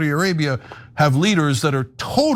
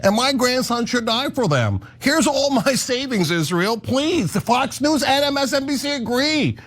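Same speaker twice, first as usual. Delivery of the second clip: mock senior citizen; 8 semitones higher than usual. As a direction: play a gullible cable-news grandparent. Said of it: "And they're like".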